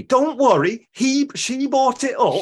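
put-on high, slow northern Mancunian accent, pitched well up; hammed-up northern English accent; imitating Daphne's northern accent. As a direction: play up Daphne's Manchester vowels. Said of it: "can't help doing it and sort of imitating it".

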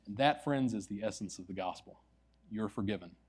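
quiet, tender closing blessing; soft sermon close. Real, not acted. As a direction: bring it home gently to the congregation. Preacher's closing application.

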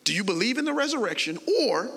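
rattled off fast. Shown as a demonstration. Preacher rattles off the question to the church, fast. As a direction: rush the question out quickly. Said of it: "see how fast that was?".

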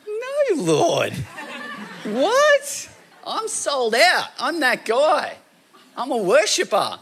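retelling how he protested to the Lord, in high mock disbelief; indignant protest. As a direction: squeak out a hurt, laughing denial. Protesting his own devotion to God.

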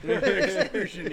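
drawn-out laughing repeat; loud amused echo. Real, not acted. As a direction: stretch it out, amused. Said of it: "it's even better".

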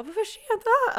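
high, loud shriek of panic; retold terrified scream; panicked shriek. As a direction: scream it out in sudden terror. Told it is too late.

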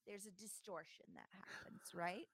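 usual speaking voice

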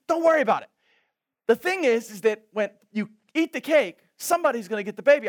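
high, loud, breathless rattling explanation; excited friend. Retold or imitated. New Orleans friend explaining Fat Tuesday, high and excited. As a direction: gush it out like it's your holiday.

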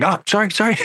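mock apology. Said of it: "I was like".

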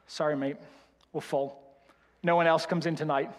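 low Birmingham doorman turning someone away; Birmingham accent. Retold or imitated. [name] turning away [name] in a Birmingham accent. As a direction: Birmingham accent, blunt and flat. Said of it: "a lot of development to get this accent".

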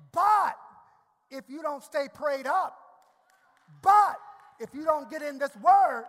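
loud, rising sermon cadence; fervent pulpit preaching; preaching at full force. Acted. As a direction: preach it hard to the congregation.

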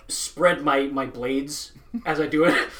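ordinary delivery

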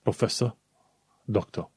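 "professor, doctor" ending in "uh", R dropped; final R as schwa. Shown as a demonstration. Teacher recapping British "professor, doctor" without final R. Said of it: "R-ul de la final îl pronunți un fel de ă".

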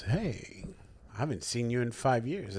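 soft, understated doctor's voice; hushed deadpan greeting; deadpan doctor imitation. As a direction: imitate a doctor dryly, barely raising the voice. Voices his insulting doctor, deadpan.

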